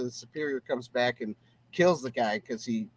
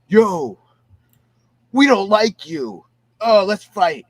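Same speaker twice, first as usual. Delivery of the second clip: mock confrontation; 4 semitones higher, 8 dB louder, slower than usual. Retold bar toughs picking a fight.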